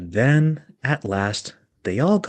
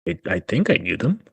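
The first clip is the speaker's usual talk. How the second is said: doubtful Michael; faster than usual. Acted. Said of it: "rather doubtfully".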